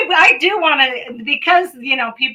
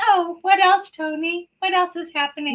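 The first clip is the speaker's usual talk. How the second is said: mock swooning; 7 semitones higher than usual. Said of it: "Glennis, of course, is like".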